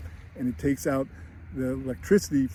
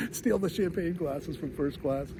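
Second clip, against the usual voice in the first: ordinary talk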